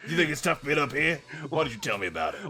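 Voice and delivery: bad British accent. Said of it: "this isn't a very good British accent".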